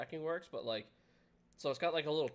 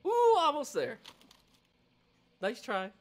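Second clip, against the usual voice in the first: high mocking taunt, ooh drawn out; playful high taunt from the delivery line; teasing tracking line; 13 semitones higher, 8 dB louder, slower than usual. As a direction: tease like a cruel progress bar. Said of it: "the line's like".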